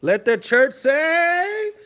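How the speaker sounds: long, high, loud drawn-out call; hammed-up preacher's call and response; mock church preacher. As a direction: lead the congregation in a stretched-out amen.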